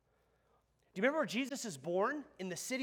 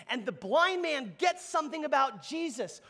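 normal talking voice

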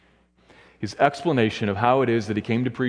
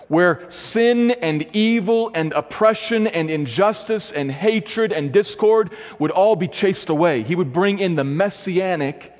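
rising preaching; 6 semitones higher, slower than usual. Acted. Preacher to congregation on the coming age, fervent and rising. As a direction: lift the congregation toward the hope.

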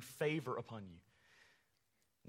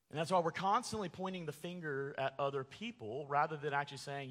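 ordinary talk

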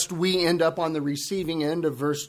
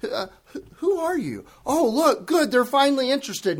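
much higher, sweet, naive tone; mock polite curiosity; 7 semitones higher than usual. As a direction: parody the sanitized reading. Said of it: "so sanitized in modern English translations".

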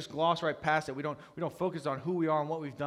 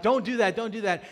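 higher, louder repeated plea; alarmed protest; 9 semitones higher, 10 dB louder than usual. Retold or imitated. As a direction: reenact trying to stop her. Acted repeated objection to wife.

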